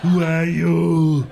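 put-on slow, heavy Austrian accent questions; Schwarzenegger impression. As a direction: drag each word out in an Austrian accent. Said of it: "That voice he uses when he asks a question".

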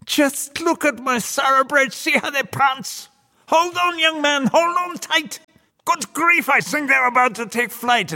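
put-on very high, excited old devil cries; exultant Old Nick. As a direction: whoop with glee while driving a runaway team. Devil exults during wild ride.

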